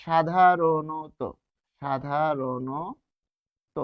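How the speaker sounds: "সাধারণত" very slow, every syllable stretched; syllable-by-syllable demonstration. Shown as a demonstration. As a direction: spell out "সাধারণত" by ear, sha-dha-ro-no-to. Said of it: "যেমন". ("সাধারণত" খুব ধীরে, টেনে টেনে)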